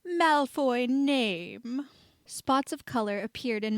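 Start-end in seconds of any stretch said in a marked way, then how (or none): 0.0-1.9 slow, raised, funny posh drawl; mock an upper-class accent. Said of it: "Ron put on a funny, posh voice".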